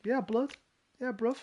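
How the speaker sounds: high, cheeky London geezer voice; London street accent. Pretend. Answering chat as a street geezer, London accent. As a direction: play a street geezer, bruv and all. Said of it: "some geezer on the street".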